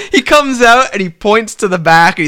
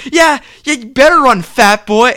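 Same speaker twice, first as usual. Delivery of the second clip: high, raised-voice mock jeer; taunting; 7 semitones higher, 4 dB louder than usual. Rival wrestler heckling the man walking away, taunting.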